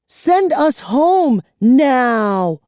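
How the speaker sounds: high, loud, slow order; commanding girl. A narrator's reading. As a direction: order him fiercely. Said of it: "Kara ordered him".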